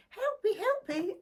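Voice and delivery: squeaky high cry of help me, help me; tiny fly voice. Retold or imitated. Reader to viewers, mimicking the fly film in a high squeaky voice. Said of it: "It's a fly going".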